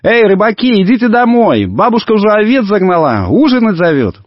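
put-on high-pitched girl's call; calling from afar. A narrator's reading. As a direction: call out as a little sister from a distance. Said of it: "закричала издалека".

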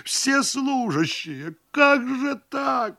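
high-pitched, drawn-out pleading wail. A narrator's reading. Host pleads into the telephone, wailing. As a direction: wail in protest, stretching the words out high. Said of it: "и опять вопль".

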